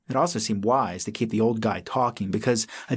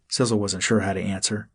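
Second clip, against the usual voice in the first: ordinary delivery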